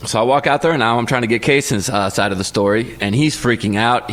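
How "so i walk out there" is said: normal talking voice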